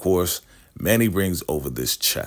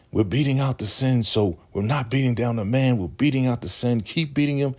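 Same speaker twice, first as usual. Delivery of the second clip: higher, eager mock fervor; zealous cult member; 7 semitones higher than usual. Smiling lady urging the crowd to keep beating, zealous. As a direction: cheer on the beating like a true believer.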